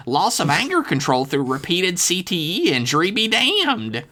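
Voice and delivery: put-on high, earnest nerdy kid voice; high, squeezed, voiced stage whisper; mock whispering Dev. A narrator's reading. Dev confiding in his ghost friend, high and secretive. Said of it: "Dev whispered as low as he could".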